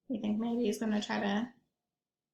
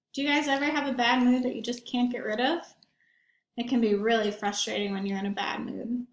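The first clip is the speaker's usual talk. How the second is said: usual speaking voice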